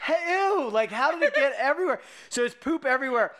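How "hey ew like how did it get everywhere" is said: grossed-out surprise. Retold or imitated. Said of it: "I'm like".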